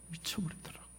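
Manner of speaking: very soft, almost voiceless; hushed repeat. Real, not acted. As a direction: let the words drop away to nearly nothing. Hushed repeat of father's breakdown.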